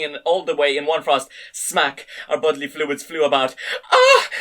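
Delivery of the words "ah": very high, loud shriek; a sudden piercing shriek. A narrator's reading. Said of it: "she let out a shriek".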